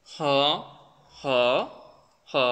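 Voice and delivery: "হ" said as isolated syllables; teacher modelling Chinese he as "হ"; pronunciation demo. Shown as a demonstration. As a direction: drill "হ" with pauses between. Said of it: "এটা কিভাবে উচ্চারণ করা হয়".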